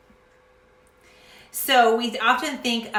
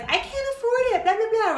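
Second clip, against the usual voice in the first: mocking whine; 12 semitones higher, 3 dB louder than usual. Mocking excuse-makers.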